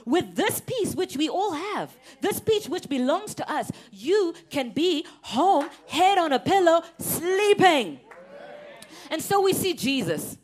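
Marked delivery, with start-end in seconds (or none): 3.9-8.0 loud, slow, high preaching refrain. Acted. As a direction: stretch each phrase out over the congregation.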